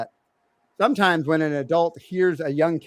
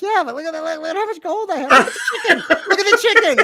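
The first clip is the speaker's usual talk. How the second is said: put-on much higher, giddy little boy voice; excited kid voice; 13 semitones higher, 6 dB louder, faster than usual. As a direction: gush like a thrilled eight-year-old, louder on the chicken. Dad voices excited young son.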